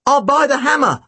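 high-pitched exclamation; urgent boy's interruption; exclaiming. A narrator's reading. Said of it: "I exclaimed".

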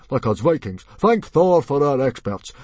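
booming chief covering up; chief Stoic. A narrator's reading. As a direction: proclaim it to rally the tribe. Plays Stoic.

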